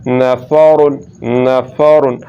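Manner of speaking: नफ़ारुन with a thick, rounded r; emphatic Arabic r in नफ़ारुन; heavy R. Shown as a demonstration. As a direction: fill the mouth on रुन in नफ़ारुन. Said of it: "मोटा करके पढ़ेंगे".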